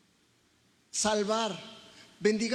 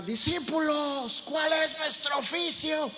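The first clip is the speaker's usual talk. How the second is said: higher, louder battle call, drawn out; rally the troops like Leonidas; war cry shout; 8 semitones higher, 5 dB louder, slower than usual. Pretend. Pastor shouts to the church as a Spartan king, rousing battle cry. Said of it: "y yo te gritara".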